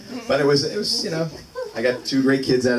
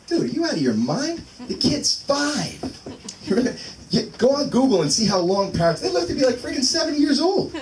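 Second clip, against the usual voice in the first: high, rising disbelief at a friend's plan; incredulous rant; 11 semitones higher than usual. Retold or imitated. To his buddy about a parrot, incredulous high rant. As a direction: pitch climbing higher as the outrage builds. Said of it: "And I thought".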